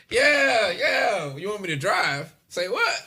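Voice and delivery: put-on high, loud drunken whooping; drunk bravado. Drunk nephew offering to drive, hammed-up bravado. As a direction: holler it like an overeager drunk. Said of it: "I'm fucked up".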